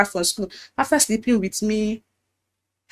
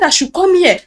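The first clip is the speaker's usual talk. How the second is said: higher, louder command; imitating pastor's order; 8 semitones higher, 5 dB louder than usual. Pastor ordering Ekene to his parlor, higher commanding voice. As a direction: order her over like the pastor.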